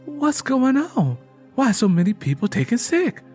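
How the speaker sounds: the fear rising as people fall ill; alarmed. A narrator's reading.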